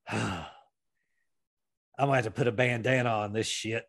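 a hint of a worn-out brawler tying off a cut; light mock Ricky Morton line; imitating Ricky Morton. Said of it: "he looks at me and he says".

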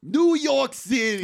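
put-on high, slow, loud New York accent holler; hammed-up New Yorker. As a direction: crash in, proudly stretching out the city name. Running New Yorker gag repeated.

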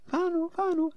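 high-pitched mock mum calling out; high sing-out of a name. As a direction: imitate mum calling from another room. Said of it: "My mum's calling my name like".

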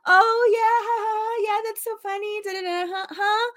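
airy high phone gossip, ignoring the caller; mom chatting on the phone. Retold or imitated. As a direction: mimic a distracted mother gabbing with friends. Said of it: "Like, she would be doing that".

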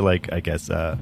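usual speaking voice

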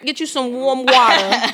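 slow, laughing "warm water" in mom's voice. Retold or imitated. Daughter imitating mom's remedy advice, slow and laughing. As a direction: linger on "warm" like a fussing mother. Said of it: "it's just the way she said warm".

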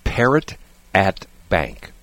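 word by word, spaced out; slow, deliberate repeat. Real, not acted. Host repeating his list item to co-host, slow and deliberate. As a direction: spell it out slowly for the listener. Repeating for listener who missed it.

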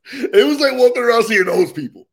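normal talking voice

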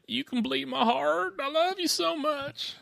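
high, slow, hammed-up wail; mock crying. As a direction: imitate a weepy lover dragging out every word. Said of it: "He's on the phone crying".

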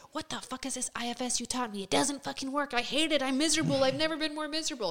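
high, exasperated complaint; upset rant to Christina. Retold or imitated. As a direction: vent to her like you're at your wits' end. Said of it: "I called Christina one time being like".

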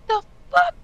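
very high, loud alarmed yell; a startled rogue yelling at the goblins; shout. Acted. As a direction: shout it for the whole room to hear. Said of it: "I'm gonna shout".

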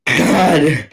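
ordinary talk, nothing marked

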